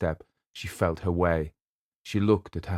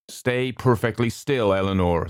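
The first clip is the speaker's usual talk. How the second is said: calm command; 4 semitones higher, 4 dB louder than usual. A narrator's reading. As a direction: hold her still, gently. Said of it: "the doctor said".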